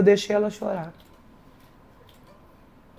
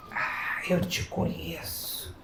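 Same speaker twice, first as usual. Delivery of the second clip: knowing hush; 5 semitones lower, 15 dB quieter, much slower than usual. Retold or imitated. Teacher knowing remark.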